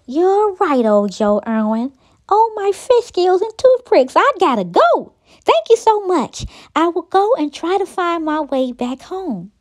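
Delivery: put-on high, gushing young character voice; excited little snake. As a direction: pipe up high with sudden urgency. Lucy's excited thanks and farewell.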